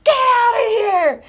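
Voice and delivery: acted-out old man yelling; neighbor's yell. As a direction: holler high to shoo a dog.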